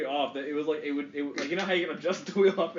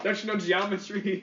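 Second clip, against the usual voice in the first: mock challenge, pitched higher; lightly hammed-up incredulous question; imitated intimidating dad; 6 semitones higher, 4 dB louder than usual. Quoting the dad judging pool skills, mock challenge. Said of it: "he was like".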